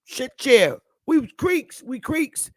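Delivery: high southern drawl saying "we was Creeks"; imitating a southern elder with pride; southern accent. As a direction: sound like a proud southern elder. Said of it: "when you hear a southern person say".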